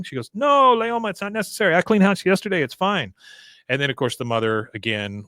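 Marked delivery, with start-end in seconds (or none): 0.3-3.1 higher, a touch louder protest; lightly voiced Min. Host voices Min refusing the cleaning, higher and louder. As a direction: fluster it out.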